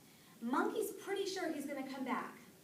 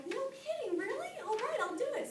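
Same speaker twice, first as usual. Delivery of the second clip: much higher, excited little squirrel voice; a tiny squirrel too thrilled to think; squeaky squirrel; 12 semitones higher than usual. A narrator's reading. Little squirrel eagerly agrees.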